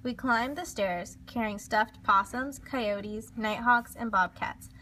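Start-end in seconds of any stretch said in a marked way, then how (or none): none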